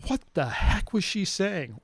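slow, drawn-out puzzled question; cleaned-up retelling of a baffled question; retold bafflement. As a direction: stretch it out in mild disbelief. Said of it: "quite say it that way".

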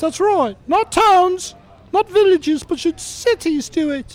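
very high, squeaky bunny puppet voice; high-pitched cartoon rabbit; Sid. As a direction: play a talking rabbit way up high. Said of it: "in Sid's voice".

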